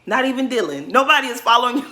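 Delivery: exasperated call-out; emphatic rant. Real, not acted. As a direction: shut him down hard. Ranting at player on screen.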